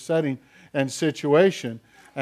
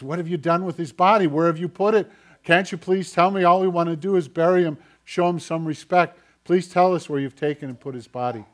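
quick, raised, urgent plea without tears; higher, louder, hurried retold appeal; mock distraught pleading; 4 semitones higher, 6 dB louder, faster than usual. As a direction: imitate a grieving woman begging for answers. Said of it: "she's crying, she's wailing".

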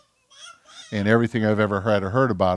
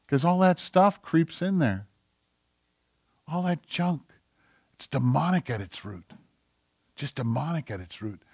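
softer, sober warning; quiet preaching; 4 semitones higher, 6 dB quieter than usual. Acted. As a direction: bring it down to a hushed warning. Drops soft after climax.

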